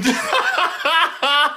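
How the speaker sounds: burst of laughter. Real, not acted. Laughs at accusation.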